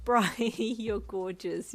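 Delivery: laughing through the words, slow; fond chuckle mid-line; chuckling affectionately at a friend. A narrator's reading. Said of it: "you said with a chuckle".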